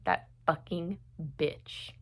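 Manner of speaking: quiet, real contempt; pissed off. Real, not acted. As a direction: curse her under your breath, pausing before the last word. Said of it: "it pissed me off".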